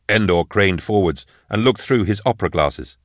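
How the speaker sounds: plain reading voice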